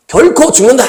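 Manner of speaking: God's absolute warning, said firmly; firm declaration. Acted. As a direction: declare it with no room for doubt. Pastor voices God's firm word.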